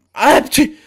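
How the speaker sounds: high, loud sneeze. Acted. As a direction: burst out in a squeaky sneeze. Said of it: "çok hapşırıyorlar". (hapşırma)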